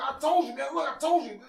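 very high, loud mock vindication; triumphant stranger. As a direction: crow it like you just won a bet. Stranger triumphant at guessing right.

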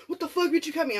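put-on angry boyfriend. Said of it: "he's like".

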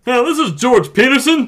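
high, loud put-on dad voice; George Peterson voice. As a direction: boom it out as a stuffy father. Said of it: "That's so good".

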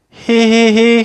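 high, loud, monotone hee-hees; miserable fake laugh. A narrator's reading. Little Miss Giggles tries again to giggle, miserably. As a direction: force each joyless hee on one note. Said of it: "she said miserably".

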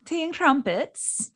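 tea and crumpets slowed down in a British accent. Shown as a demonstration. Friend correcting her, slow British accent. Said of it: "Did I say that right?".

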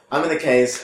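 ordinary talk

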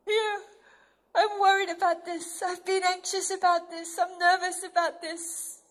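put-on a child confessing worries to God; anxious believer. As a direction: bring your worries to a father. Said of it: "we come to him and we say".

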